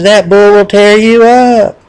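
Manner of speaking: loud, high, slow cry of warning; alarmed warning. Acted. Bulls warning the little bull, alarmed. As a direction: cry out the warning, stretching the words.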